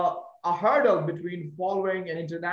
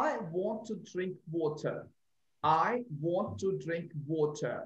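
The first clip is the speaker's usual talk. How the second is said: soft, lower British "water" with a crisp T; British accent; 3 semitones lower, 6 dB quieter than usual. Shown as a demonstration. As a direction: say it the British way, lips rounded on "water". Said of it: "she is actually British".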